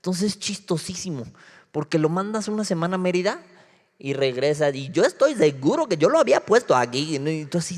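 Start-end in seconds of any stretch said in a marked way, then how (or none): none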